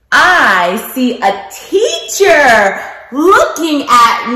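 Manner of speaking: lilting picture-book narration with big questions; reading to children. Acted. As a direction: read it like circle time for little kids.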